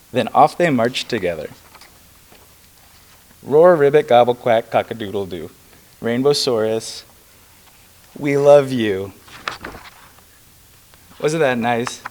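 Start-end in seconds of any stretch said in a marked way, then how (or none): 0.1-9.1 story read-aloud. Acted. Candidate reads a children's book at a press conference, lively storytelling. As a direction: read it like story time with animal noises.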